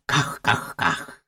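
soft, slow whispered quack; drowsy whispered quacking; duck quacking. A narrator's reading. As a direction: breathe out a lazy duck's quack. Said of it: "приказуючи".

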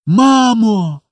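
put-on high, drawn-out child's shout; joyful cry. A narrator's reading. Children rush to the door for mama, overjoyed. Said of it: "з криком радості".

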